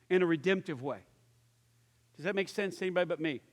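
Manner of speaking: ordinary talk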